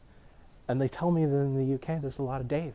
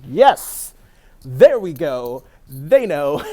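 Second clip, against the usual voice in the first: much louder, delighted cheer; triumphant yes; 2 semitones higher, 13 dB louder, much slower than usual. Retold or imitated. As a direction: celebrate being recognized at last. Relieved they know he's Canadian.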